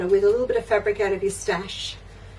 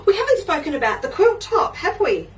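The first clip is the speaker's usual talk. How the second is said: gasp, then a laughing, higher rush; real surprise breaking into a laugh; sudden realisation; 10 semitones higher than usual. Real, not acted. Host suddenly remembers the quilt top for viewers, gasping.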